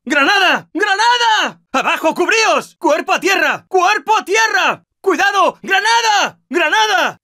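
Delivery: high urgent yell; shouted warning. Acted. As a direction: bellow the warning to the team. Warning team of grenade.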